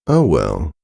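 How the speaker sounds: ordinary delivery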